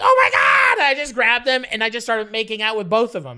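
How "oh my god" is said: high, loud oh my God; excited disbelief. Retold or imitated. Teen spotting both girls at the festival, thrilled. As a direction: gasp it out in thrilled surprise. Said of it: "And I'm like".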